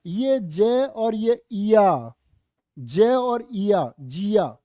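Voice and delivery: जय and इया drawn out, slowly split apart; slow syllable breakdown. Shown as a demonstration. Teacher breaking Jiya into sounds for the class, slow. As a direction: spell out Jiya slowly, part by part. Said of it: "क्यों बोल रहे थे जी हमको इसको जिया".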